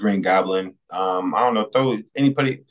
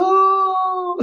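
high scream; 4 dB louder than usual. Retold or imitated. Brother killed in the scene.

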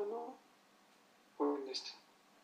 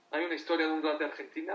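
ordinary talk, nothing marked

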